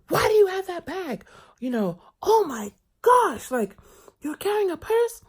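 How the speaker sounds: high, loud mocking voice of a prying older woman; scandalized nosy lady. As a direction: grill him like a scandalized auntie. Lady disgusted at purse.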